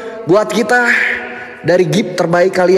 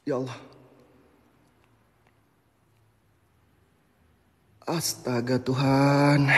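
much softer and lower, barely voiced; hushed fright; 9 semitones lower, 13 dB quieter, slower than usual. Real, not acted. Streamer spooked in the dark, hushed and low. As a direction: breathe it out in fear. Frightened after seeing figure.

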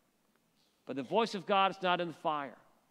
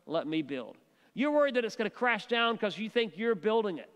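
ordinary delivery

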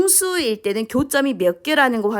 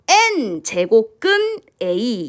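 "N 제곱근 A" drawn out in separate chunks; slow, deliberate classroom reading; read-along demo; much slower than usual. Shown as a demonstration. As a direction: model it slowly for students to repeat. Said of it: "다시 따라해볼까요?".

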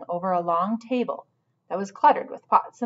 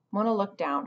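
ordinary delivery

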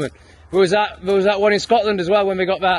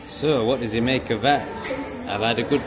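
lower, softer, settled-down voice; calmer now; 7 semitones lower, 6 dB quieter than usual. Real, not acted. Telling viewers he's calmed down after the gym, low soft voice. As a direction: wind down and speak easy after the gym. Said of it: "I'm feeling a lot calmer now".

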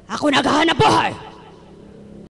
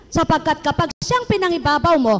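usual speaking voice

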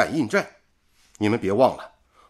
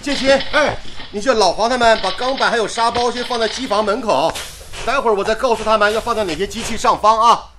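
raised voice giving orders across the site; calling out; 5 semitones higher than usual. Acted. Supervisor shouting orders to workers moving steel plates, calling out. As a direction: call out instructions over the work noise.

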